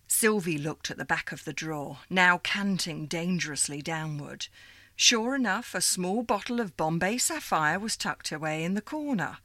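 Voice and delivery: ordinary delivery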